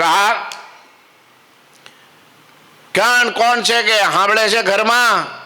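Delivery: high, slow call into the dark house. Acted. Monk calls out to the sleeping house, high and slow.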